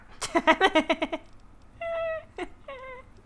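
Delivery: squealing laughter; high-pitched laugh. Real, not acted. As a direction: burst into a shrill laugh. Reacting to game chaos.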